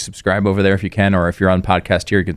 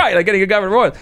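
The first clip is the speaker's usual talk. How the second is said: quick, higher, amused; laughing; 10 semitones higher, faster than usual. Real, not acted. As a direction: repeat the quip through laughter. Laughing repeat of quip.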